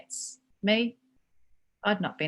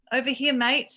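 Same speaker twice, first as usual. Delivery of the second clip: loud call across the room; 7 semitones higher, 7 dB louder than usual. Acted. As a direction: call out to him. Boss calling out.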